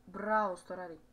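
ordinary talk

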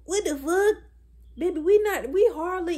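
high, loud what-the-fuck; outraged disbelief. Real, not acted. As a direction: shout it in disbelief. Shocked outrage.

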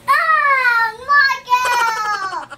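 loud sudden yell; shouting. Real, not acted. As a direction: yell the name out. Repeat of shout.

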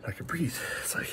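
ordinary delivery

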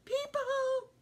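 dramatic gasp before the reveal; gasped surprise. A narrator's reading. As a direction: build to a startled gasp on people. Narrator gasps at discovery.

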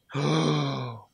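sharp, loud intake of breath; shocked gasp. Real, not acted. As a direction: gasp at a surprise discovery. Sudden find in the game.

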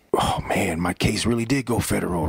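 hushed whisper of disbelief; whispered realisation. Retold or imitated. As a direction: whisper it to yourself, stunned. Retelling his stunned inner reaction.

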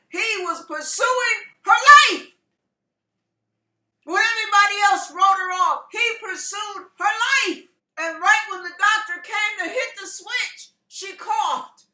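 high, loud climactic preacher cry; full-force preaching. Real, not acted. Preacher reaching the moment she coughed, full-force fervor. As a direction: build the testimony to its peak.

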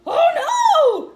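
loud, high "oh no"; alarmed cry. Acted. Father cries out as the crowd carries him off, loud. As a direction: gasp it out in alarm. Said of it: "said her father".

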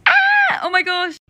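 high, loud shriek of joy; thrilled fan screaming; excited scream. Acted. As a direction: scream in delighted shock. Teen realises concert is today.

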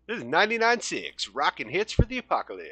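high, swooping rock-station announcer; radio DJ voice. Acted. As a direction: hype it like a rock radio jingle. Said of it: "adapt a radio voice".

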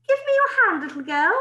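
put-on high, loud, fussy old woman; bossy old lady. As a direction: boom it out like an interfering granny. Old woman grabs Bill's hand.

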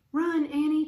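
loud, high shout to flee; urgent cry. A narrator's reading. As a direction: cry out a warning. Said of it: "cried Jack".

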